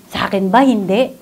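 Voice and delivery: usual speaking voice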